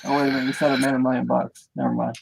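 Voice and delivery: usual speaking voice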